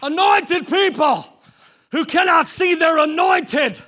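loud, high, shouted sermon climax; full-force preaching. Real, not acted. Preacher shouting to the congregation in full force.

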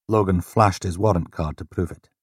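ordinary delivery, nothing marked

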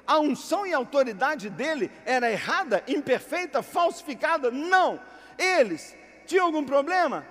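loud, high rhetorical questions; fiery preaching. Acted. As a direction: drive each question at the crowd.

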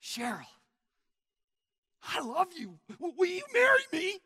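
high, loud, building impassioned proposal; intense pleading. Acted. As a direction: propose with all your heart, ending very high. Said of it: "Folks, it makes a difference".

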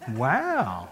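ordinary talk

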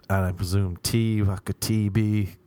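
usual speaking voice

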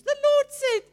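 mock prophetic squeal, very high and loud; high, breathless prophesying. Zambian lady prophesying in English, high mock shriek. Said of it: "my voice goes high and I can't breathe".

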